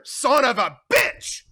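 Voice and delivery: Sonny cursing Carlo, loud; furious curse. Acted. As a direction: explode with rage.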